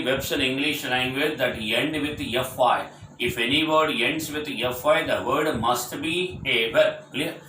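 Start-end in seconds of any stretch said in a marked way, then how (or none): none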